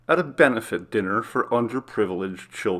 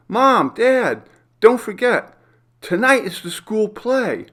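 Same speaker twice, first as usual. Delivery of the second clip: put-on much higher, louder child voice; little girl; 12 semitones higher, 6 dB louder than usual. Youngest daughter reminding her parents at breakfast, high excited child voice. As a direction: chirp it like an eager young girl. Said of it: "said Trish, their youngest".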